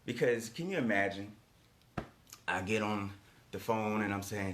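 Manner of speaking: ordinary talk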